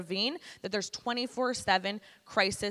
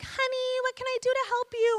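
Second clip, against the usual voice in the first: very high, loud Hungarian lady voice; Hungarian accent; 5 dB louder than usual. Retold or imitated. Hungarian lady offering help to a caller, thick high-pitched accent. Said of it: "Hungarian lady with a thick accent".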